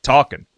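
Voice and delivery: "talking" said as talkin'; "talking" ending in a plain N, Southern style; dropped G. Shown as a demonstration. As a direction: drop the final G in "talking". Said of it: "didn't even put G's on them".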